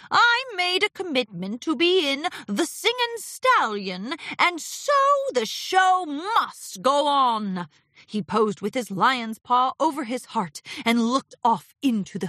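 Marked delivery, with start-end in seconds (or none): 0.1-7.7 high, grand declaration slowing at the end; theatrical Discord, grandly resolute. Acted. Discord vowing the show will continue, theatrically. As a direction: declaim it like a stage star.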